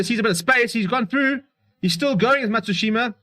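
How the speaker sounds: rising thrill as he breaks; excited commentary. Real, not acted. Break through live play.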